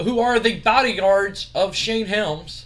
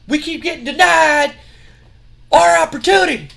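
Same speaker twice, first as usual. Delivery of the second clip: much higher, much louder hammed-up tirade; furious rant; 10 semitones higher, 8 dB louder than usual. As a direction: roar every complaint at them. Scott Steiner rants about rematch.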